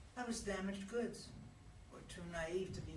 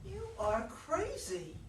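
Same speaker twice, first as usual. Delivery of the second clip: high, slow, disbelieving you are crazy; incredulous; 10 semitones higher, 4 dB louder, much slower than usual. Acted. Regina scoffing at Anthony's talk of their parents, incredulous. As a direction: stretch it out in disbelief. Regina rejects his view.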